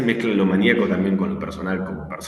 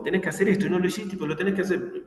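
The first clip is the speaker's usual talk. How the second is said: fast, higher mock demanding boss; jefe exigente; 10 semitones higher, faster than usual. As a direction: rattle off orders like a pushy boss. (jefe intenso que exige tareas a la empleada, imitación rápida y aguda)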